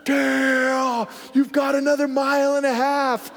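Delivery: put-on urgent hushed shout from an old coach; whisper yelling. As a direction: shout it under your breath, urgently. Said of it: "whisper yelling".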